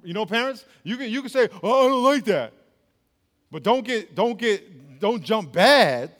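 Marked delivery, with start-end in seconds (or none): 1.6-2.5 higher-pitched mock protest; complaining child. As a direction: lightly imitate a kid objecting. Voices a child objecting to parents.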